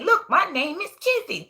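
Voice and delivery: a hint of excited little Kizzy; proud little girl. Child shows off her writing.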